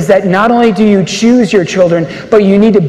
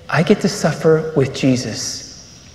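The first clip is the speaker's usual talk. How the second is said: daughter's gentle words; 6 semitones lower, 8 dB quieter than usual. Retold or imitated. Daughter's words quoted in story.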